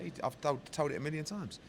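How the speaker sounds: normal talking voice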